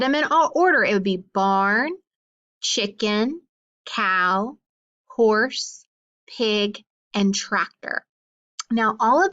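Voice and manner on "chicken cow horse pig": ordinary talk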